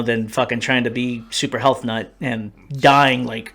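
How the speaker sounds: ordinary talk, nothing marked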